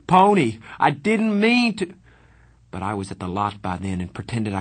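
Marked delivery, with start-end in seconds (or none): 0.1-1.9 high loud desperate scream. A narrator's reading. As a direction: scream after him. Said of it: "Darry screamed".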